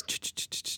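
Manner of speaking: soft unvoiced clicking; roach clicking noise. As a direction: mimic roaches skittering in the dark. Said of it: "I'd hear the sounds".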